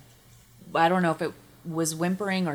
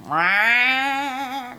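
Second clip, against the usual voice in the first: cat howl; 5 semitones higher, 4 dB louder than usual. Retold or imitated. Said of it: "They do, like".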